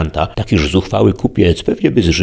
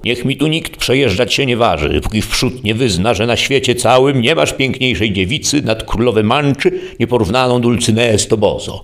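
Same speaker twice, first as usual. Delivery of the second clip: higher, raised knightly challenge; bold challenge; 6 semitones higher than usual. A narrator's reading. Don Quixote bars the merchants' way, bold raised challenge. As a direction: call out boldly like a knight barring the road. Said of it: "podniósł głos i zuchwale zawołał".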